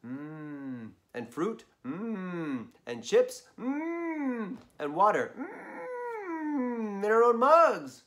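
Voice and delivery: savoring mms rising up high; yummy mms. Acted. Narrator listing picnic treats, savoring each with mm. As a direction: relish each snack with a rising mm.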